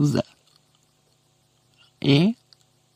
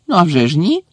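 abrupt, slightly raised denial; sharp retort; 4 semitones higher, 4 dB louder, faster than usual. A narrator's reading. Professor snapping back at the caretaker, sharply. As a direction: deny it sharply, pitched up. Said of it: "різко сказав Снейп".

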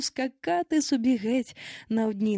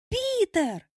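put-on high-pitched boys' cry of a name; high, drawn-out call; excited exclamation; 12 semitones higher than usual. A narrator's reading. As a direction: exclaim it high, like boys greeting their leader. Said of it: "хором вигукнули хлопчаки".

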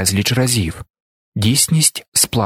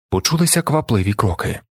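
plain reading voice

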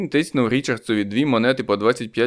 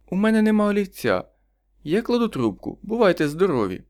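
higher, curt woman's voice; dismissive neighbour; 9 semitones higher than usual. A narrator's reading. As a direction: brush him off, higher. Neighbour refusing on phone. (відмахується, вищим голосом)

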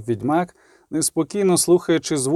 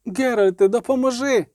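high-pitched plea; urgent cry for help; 9 semitones higher than usual. A narrator's reading. Girl calling the witcher to help, urgent high cry. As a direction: call up high for rescue.

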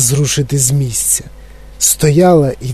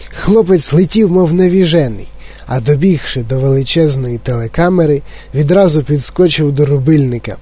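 ordinary delivery

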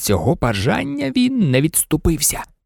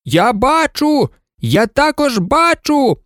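high, drawn-out pilot's cry from above; calling out; 9 semitones higher, slower than usual. A narrator's reading. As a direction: call down slowly from the sky. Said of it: "крикнув згори сидячий пілот". (протяжний високий вигук згори)

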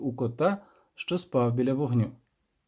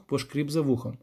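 ordinary delivery, nothing marked

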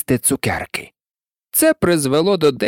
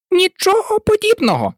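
very high, shrill and cross mouse; piercing angry cry. A narrator's reading. Mouse angrily contradicts Alice, piercing cry. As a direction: cry out crossly, voice shooting right up. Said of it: "закричала миша пронизливо і дуже сердито".

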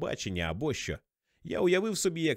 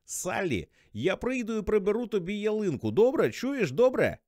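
put-on high, loud drunk pestering; over-eager drunk shouting down the phone; drunken insistence; 8 semitones higher, 4 dB louder than usual. A narrator's reading. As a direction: push louder for a yes, tipsy and stubborn. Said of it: "але ти п'яний".

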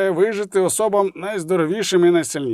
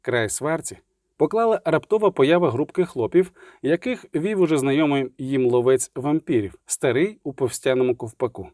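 plain reading voice

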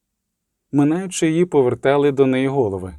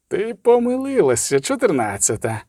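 high taunt dropping at the end; mocking inhuman voice; 6 semitones higher than usual. A narrator's reading. Inhuman voice mocking the sorceress for her mistake, coldly taunting. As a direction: jeer coldly, starting high. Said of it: "насміхався металевий нелюдський голос".

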